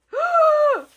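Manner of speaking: sharp, high, loud gasp; big astonished gasp; shocked gasp. Real, not acted. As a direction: gasp loudly in disbelief. Shocked by the gift.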